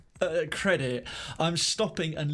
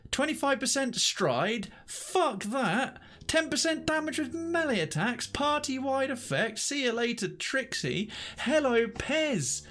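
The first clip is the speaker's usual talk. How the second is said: excited; 8 semitones higher than usual. Real, not acted. Comparing pets, thrilled.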